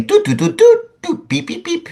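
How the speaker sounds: high sung doot doot beep; sung calculator beeps. Retold or imitated. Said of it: "he'd sing".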